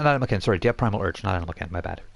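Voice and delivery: usual speaking voice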